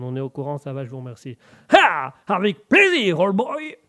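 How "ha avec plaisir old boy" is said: loud, high mock-American French; hammed-up American exclamation; American accent. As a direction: boom it out like a stage American. American character butts in.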